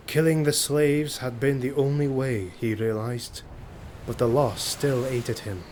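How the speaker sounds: ordinary delivery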